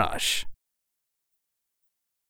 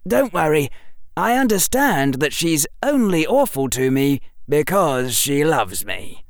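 put-on slightly theatrical, higher boy's voice; reassuring boy; 6 semitones higher than usual. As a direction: reassure the salesgirl, easing down at the end. Narrator voices the boy Harry.

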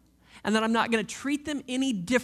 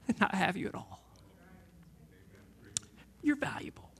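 soft whispered reassurance; tender whisper; 4 semitones lower, 8 dB quieter than usual. Acted. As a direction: breathe it gently to the child. Father reassuring child.

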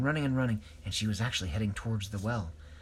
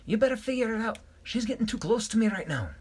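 Shellington high and a bit louder; high panicky voice; 11 semitones higher, 4 dB louder than usual. A narrator's reading. Shellington begging friends as the girl comes close, high. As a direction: squeak it out, nervous.

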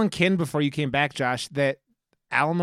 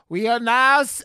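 loud, higher mock-Transylvanian voice; bad Transylvanian accent; 8 semitones higher, 5 dB louder than usual. Imitating the cinema worker's announcement, horrible Transylvanian accent. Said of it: "horrible Transylvanian accent and is like".

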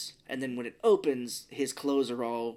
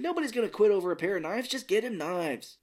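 mock exasperation, higher voice; exasperated boss; 8 semitones higher, 4 dB louder than usual. Host voicing the boss about the chef's knives, mildly exasperated. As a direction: dismiss it impatiently, pitched up.

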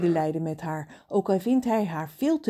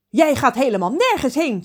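higher, louder angry mother; mother shouting; 8 semitones higher, 8 dB louder than usual. Acted. Furious mother shouting at her son on the phone, loud. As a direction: yell the order down the phone. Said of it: "schreeuwt zijn moeder".

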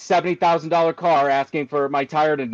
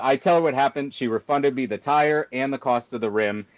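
usual speaking voice

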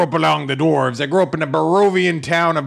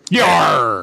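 a hint of a pirate growl; pirate yar; 3 dB louder than usual. Cleric crying out in pain, lightly pirate. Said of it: "you sound like a pirate".

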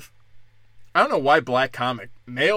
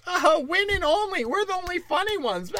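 much higher, giggly gloating; mock smug woman; 13 semitones higher than usual. Comic mocks women hecklers, high and giggly.

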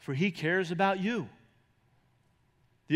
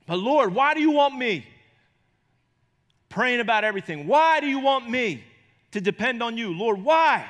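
louder, higher, pleading why to the Lord; pleading believer; 5 semitones higher, 6 dB louder than usual. Acted. A believer questioning God, loud and pleading. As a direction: beg the Lord for a reason.

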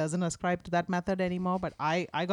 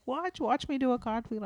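higher-pitched imitation of a little niece; six-year-old niece; 7 semitones higher than usual. Niece calls her aunt to show off, imitated higher child voice. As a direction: imitate an eager child calling for attention. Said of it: "she'll call me and she's like".

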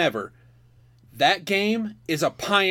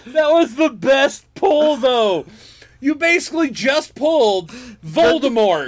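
excited; 4 semitones higher, 4 dB louder than usual. Real, not acted. Excited over the lucky pull.

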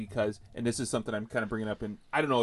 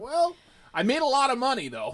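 smug actor; 13 semitones higher, 3 dB louder than usual. Retold or imitated. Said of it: "but it's like".

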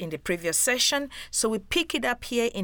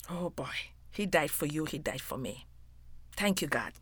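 softer and lower, deeply thankful; hushed gratitude; 5 semitones lower, 8 dB quieter than usual. Real, not acted. Preacher moved that Jesus died for us, hushed gratitude. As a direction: drop to a quiet, heartfelt thanks.

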